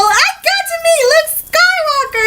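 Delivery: high-pitched gleeful gushing; so happy. Retold or imitated. Crew member wanting to tell his people at home, overjoyed. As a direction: gush like an overjoyed fan. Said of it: "and be, like, so happy".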